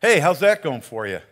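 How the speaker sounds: loud, teasing jab at the fishermen; mock the empty nets with sarcasm; sarcastic. Jesus teasing his fishermen friends, loud and sarcastic. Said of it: "more of a sarcastic tone that Jesus was using".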